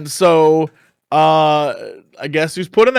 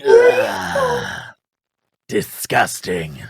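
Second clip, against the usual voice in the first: disgusted lizard; much slower than usual. Acted. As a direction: growl out your disgust.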